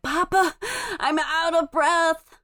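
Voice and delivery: put-on high, drawn-out small-child complaint; little child winded. Three-year-old running up to Papa, winded and whiny. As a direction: play a winded toddler, slowly and high.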